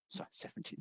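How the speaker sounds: whispered aside to self; whispered correction. Real, not acted. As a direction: breathe the correction under your breath. Speaker corrects date, under breath.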